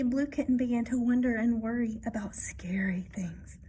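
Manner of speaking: ordinary delivery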